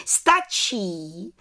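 mild call to halt. A narrator's reading. Head witch stopping the test, calling out. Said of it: "zvolala vrchní čarodějnice".

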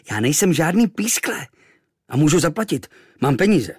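high, loud indignant insistence; defensive protest. A narrator's reading. Boy defends himself to the innkeeper, indignant. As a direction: stand up for yourself. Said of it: "bránil jsem se".

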